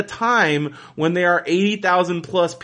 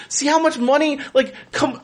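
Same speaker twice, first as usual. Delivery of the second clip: indignant fans; 9 semitones higher than usual. Retold or imitated. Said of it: "people are holding their tickets".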